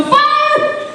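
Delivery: high panicked yelling. Retold or imitated. Running through Home Depot aisles, panicked yelling. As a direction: scream it, arms flailing.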